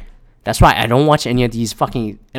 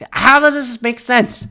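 incredulous; 9 semitones higher than usual. Retold or imitated. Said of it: "I just go".

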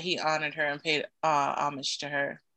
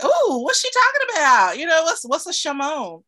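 mock baffled, pitched way up and louder; puzzled crowd; 10 semitones higher, 8 dB louder than usual. Crowd wondering what Shimon means, mock puzzled. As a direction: play the confused audience, high and incredulous. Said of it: "people were like".

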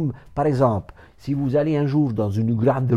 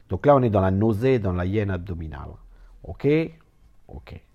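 ordinary delivery

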